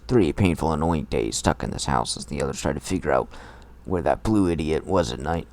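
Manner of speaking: usual speaking voice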